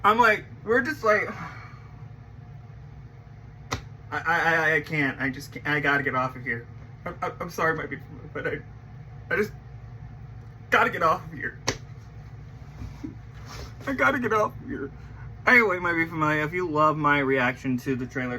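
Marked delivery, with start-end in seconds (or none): 1.3-3.1 heavy sigh. Real, not acted. Overwhelmed by emotion. 4.1-14.9 choked up. Real, not acted. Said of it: "I'm about to cry".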